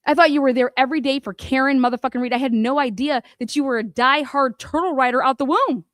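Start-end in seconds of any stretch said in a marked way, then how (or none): none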